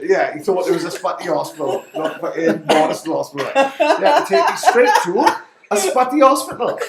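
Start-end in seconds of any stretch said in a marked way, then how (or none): 3.5-6.8 higher, louder, laughing mock quote; laughing Jeff Pegler impression. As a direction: ham up the boxer's line through laughter. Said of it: "and Jeff Pegler said".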